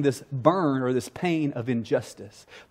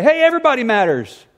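mock breezy brush-off, higher and louder; dismissive reply; 8 semitones higher, 5 dB louder than usual. Apostles brushing off the widows, mock dismissive. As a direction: wave the problem away.

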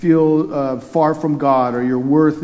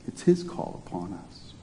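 hushed whisper; 6 semitones lower, 11 dB quieter than usual. Real, not acted. Pastor stresses God's call softly.

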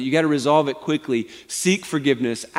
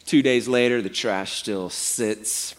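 usual speaking voice

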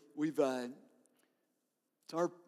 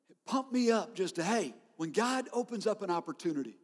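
normal talking voice

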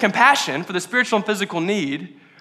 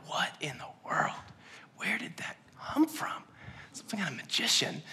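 awed whisper; 4 semitones lower, 18 dB quieter than usual. Retold or imitated. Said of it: "people are sitting here just like".

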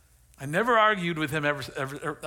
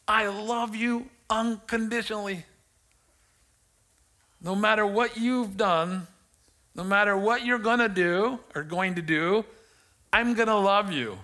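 raised, emphatic pledge of love; preacher voicing a father's fervent promise; impassioned declaration; 6 semitones higher, 3 dB louder than usual. Acting the Father's unconditional love.

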